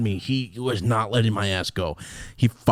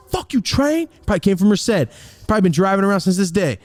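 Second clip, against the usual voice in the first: irritated outburst; 8 semitones higher, 4 dB louder than usual. Real, not acted. Breaks off to curse the train.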